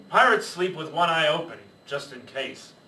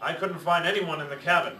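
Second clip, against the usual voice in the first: plain reading voice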